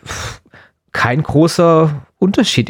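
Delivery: ordinary delivery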